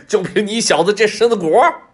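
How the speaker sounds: big mocking laugh into a high, loud jeer; loud derisive guffaw and taunt; scornful tiger laughter. A narrator's reading. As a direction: roar with laughter, then scoff.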